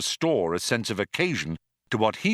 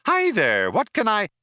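high, loud gushing computer; chirpy machine greeting; cheery computer voice; 13 semitones higher, 6 dB louder than usual. A narrator's reading.

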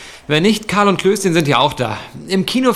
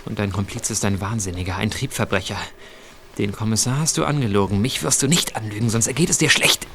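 low menace; 8 semitones lower, 5 dB quieter than usual. Acted. Threatening the accomplice in bed.

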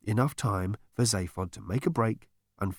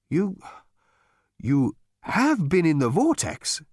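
slow, halting stammer; 6 semitones higher, slower than usual. A narrator's reading. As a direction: stumble over the words in shock. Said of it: "stammered Gargravar".

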